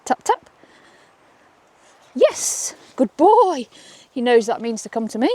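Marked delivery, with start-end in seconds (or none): 0.1-3.7 high, slow, sing-song yes; high excited praise for the dog; cheery dog praise. Shown as a demonstration. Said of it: "you ready".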